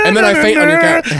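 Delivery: high, loud laugh-talk; giggly high voice. Real, not acted. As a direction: crack up mid-line. Laughing while telling the scene.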